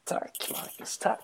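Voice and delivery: very soft, whispered thank-you; hushed thanks. Real, not acted. Host thanking co-host for sexy-voice compliment, in a whisper. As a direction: barely breathe the thanks.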